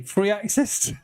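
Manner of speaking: reading it out and breaking into laughter; cracking up on the last words; laughing. Real, not acted. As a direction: voice going high as the giggles win. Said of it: "I'm struggling, trying not to cry, laughing".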